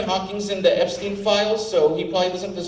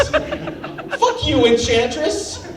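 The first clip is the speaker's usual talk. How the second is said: high mock curse; 8 semitones higher, much slower than usual. Cursing out the Enchantress.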